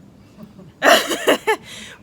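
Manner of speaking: high breathy laugh. Real, not acted. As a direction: burst out laughing. Laughs at her own story.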